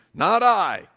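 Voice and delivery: a duck brushing off work; lightly voiced duck refusing. Duck refusing to help the hen, loud and high.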